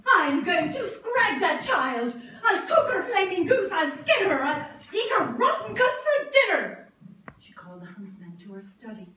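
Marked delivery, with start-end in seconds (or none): 0.1-6.9 loud raging threats, half laughing; furious yell. A narrator's reading. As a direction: scream it out in a wild fury. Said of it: "She yelled".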